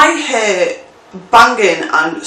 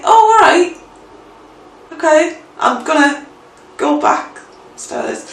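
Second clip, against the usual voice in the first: awkward retreat; 6 semitones higher than usual. Retold or imitated. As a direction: back off awkwardly, voice dropping away. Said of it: "And I was like".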